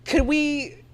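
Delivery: high, slow sing-song teasing voice; mock exasperated. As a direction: sweetly beg her to tone it down. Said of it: "I'm like".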